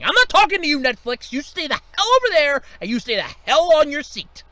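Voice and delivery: loud, high snapping orders; angry scolding. Acted. Boss barking at Netflix to stay put, angry and loud. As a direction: put them in their place, loud.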